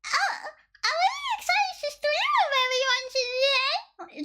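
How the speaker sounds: hammed-up kawaii debut voice, breaking into laughs; fake cute VTuber voice. Shown as a demonstration. Streamer mocking a fake-voiced VTuber debut, in a squeaky cute voice. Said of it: "That's my natural voice".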